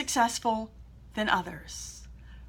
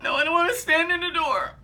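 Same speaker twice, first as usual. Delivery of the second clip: scared refusal; 3 semitones higher than usual. Retold or imitated. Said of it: "I said".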